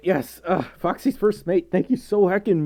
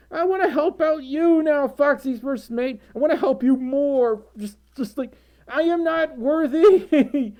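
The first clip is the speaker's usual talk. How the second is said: emotional, near tears; 8 semitones higher than usual. Real, not acted. Overwhelmed thanking donor.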